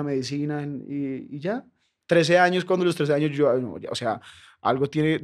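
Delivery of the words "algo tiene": normal talking voice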